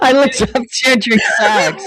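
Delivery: laughing. Real, not acted. Laughing at the search mix-up.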